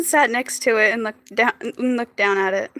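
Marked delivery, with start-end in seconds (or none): none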